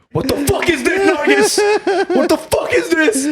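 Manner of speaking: high, slow mock dad yell; dad yelling at wife over pudding; imitating angry dad. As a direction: ham up the furious father. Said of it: "My dad was like".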